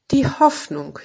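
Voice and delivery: höher und freudig ausgerufen; jubelnd. A narrator's reading. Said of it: "jubelte er". (jubelt vor Freude über die Hoffnung)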